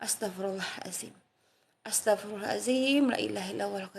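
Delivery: murmured softly under the breath; quiet sorrowful remembrance; hushed dhikr. Shown as a demonstration. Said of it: "Ketika sedang ditimpa Allah masalah".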